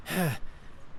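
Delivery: put-on a tired old man's sigh; worn-out sigh; weary sigh. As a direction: let out a long sigh after the ride. Old man panting in lot.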